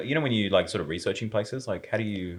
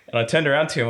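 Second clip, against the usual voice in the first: ordinary talk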